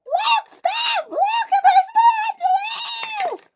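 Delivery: loud, very high shriek; screamed protest. A narrator's reading. Millie pushed off the rails by Luke, screaming. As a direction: wail and scream at the culprit.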